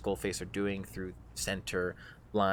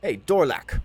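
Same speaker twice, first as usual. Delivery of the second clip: loud, higher call to an underling; 5 semitones higher, 9 dB louder than usual. Acted. Drow calls one of the bugbears, loud and commanding. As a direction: sharply hail a henchman. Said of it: "and he goes".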